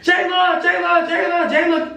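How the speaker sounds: high, shouted calling of a name. Retold or imitated. Homie screaming her name across the party, high shout. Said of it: "scream my name".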